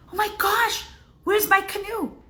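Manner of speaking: high, panicked call for the lost canoe; startled fisherman crying out; alarmed cry. Acted. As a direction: sound the alarm, voice jumping up. Man finds his canoe gone.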